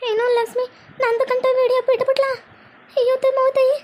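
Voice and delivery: high, rising cry of disbelief; shocked dismay. Acted. Woman shocked to learn of the leaked video, crying out high. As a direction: gasp it out in horror.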